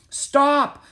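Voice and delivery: high, full-force yell; shout. A narrator's reading. Jack shouts after the stallion carrying Annie, urgent yell. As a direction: yell after a runaway horse. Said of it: "Jack shouted".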